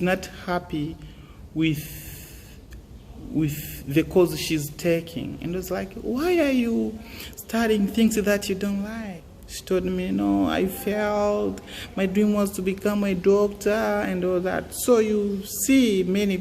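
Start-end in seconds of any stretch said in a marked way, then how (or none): none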